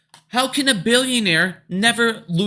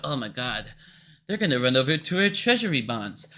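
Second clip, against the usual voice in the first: salivating government; 8 semitones lower than usual. Retold or imitated. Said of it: "government's salivating 'cause they're like".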